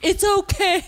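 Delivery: put-on squeaky little kid reassuring; a small child brushing it off; high kid voice. Nephew quote, high, loud, far.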